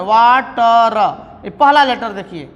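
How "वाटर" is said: वाटर drawn out very slowly, starting on the व sound; वाटर said slowly for the class; slow demonstration. Shown as a demonstration. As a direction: stretch वाटर so the first letter is clear. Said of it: "वाटर ऐसे बोल रहे हैं".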